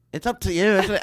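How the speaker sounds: loud, high Scottish brogue of Gary John Bishop. Retold or imitated. Friend mimics Gary John Bishop for a laughing co-host, in a loud Scottish brogue. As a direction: belt it out in a hammed-up Scottish brogue. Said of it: "I was like".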